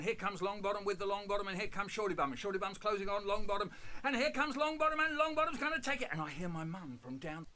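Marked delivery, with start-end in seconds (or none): 0.0-6.1 loud rising race call, faster at the finish; horse racing commentary. Retold or imitated. Boy commentating drips as a horse race, loud race call. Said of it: "start doing a running commentary".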